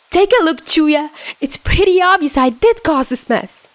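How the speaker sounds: high, strained near-scream. Acted. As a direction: scream it out in anguish. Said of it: "Dazai practically screamed".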